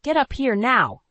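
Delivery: loud, high, urgent command; shouting up to her husband, panicked. A narrator's reading. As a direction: yell it in a panic. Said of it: "my voice trembling with panic".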